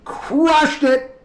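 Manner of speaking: high, loud mock brag; cocky mock "nailed it" voice; smug self-praise. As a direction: pretend you nailed it, hammed up. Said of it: "and just go".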